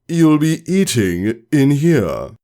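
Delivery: slow, firm command; slow firm teacher order; authoritative repeat. Acted. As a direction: leave no room to argue. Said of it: "Her voice was loud and authoritative".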